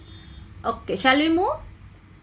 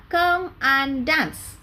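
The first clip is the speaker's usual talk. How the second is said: slow model phrase; slower than usual. Shown as a demonstration. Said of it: "You remember?".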